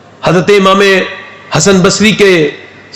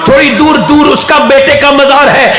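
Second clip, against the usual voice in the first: preaching at full force; 11 semitones higher than usual. Acted. Preacher to the gathering, crying out at full force. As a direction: pour it out to the crowd as a rising wail. Preacher's climax about the shrine.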